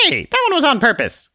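very high, tiny squeaky voice; a tiny exasperated sprite scolding; squeaky little Conscience. Acted. Conscience snaps at the man in a very high squeak.

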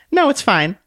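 high, polite little lady; curtsy it out sweetly, voice up high; prim little book voice. Acted. Book introduces herself to the man with a high dainty bow.

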